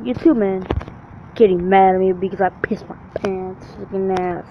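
ordinary talk, nothing marked

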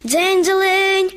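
high, slow ringing bell sound; a tinkling shop doorbell, high and drawn out; doorbell jingle. A narrator's reading. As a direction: sing it out like a little shop bell. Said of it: "задзвонив дзвіночок на дверях".

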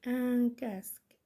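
slow, connected dictation. Shown as a demonstration. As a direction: repeat it slowly as one phrase. Repeats the dictated word as one phrase. (إملاء بطيء ومتصل)

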